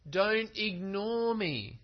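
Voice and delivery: slow, high plea; Lady Wisdom calling. Acted. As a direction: call it out high and drawn out. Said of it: "Lady Wisdom is saying".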